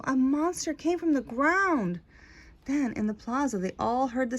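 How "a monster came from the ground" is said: put-on higher, alarmed boy telling of the monster; frightened cry. A narrator's reading. Boy Pablo telling his father about the monster, alarmed. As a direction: blurt it out as a scared boy. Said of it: "cried Pablo".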